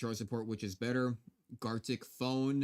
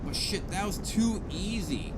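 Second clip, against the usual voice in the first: smug gloating; 6 semitones higher, slower than usual. Real, not acted. Gloating after an easy mission.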